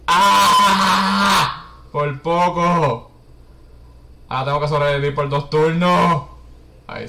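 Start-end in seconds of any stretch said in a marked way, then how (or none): 0.1-3.1 loud startled gasp, slow relief; startled cry. Acted. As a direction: cry out, nearly caught. Near death in game.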